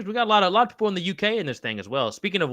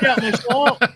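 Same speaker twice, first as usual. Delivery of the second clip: Shrek; 7 semitones higher, slower than usual. Acted. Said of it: "I've watched Shrek".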